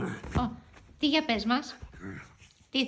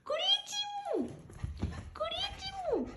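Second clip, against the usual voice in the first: much higher, tiny squeaky coo; 6 dB quieter than usual. Real, not acted. Owner cooing to her girl dog, much higher squeak. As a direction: squeak it like talking to a puppy.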